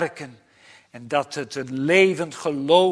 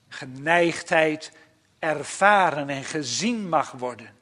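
ordinary delivery

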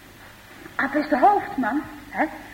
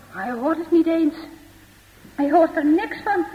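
usual speaking voice

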